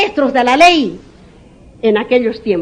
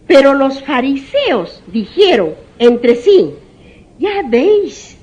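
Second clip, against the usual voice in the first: scripture reading; 3 semitones higher, 4 dB louder, much slower than usual. Acted. Preacher reading scripture aloud.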